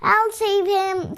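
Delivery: high, slow squeak. A narrator's reading. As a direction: squeak it out bravely. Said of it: "squeaked the squirrel".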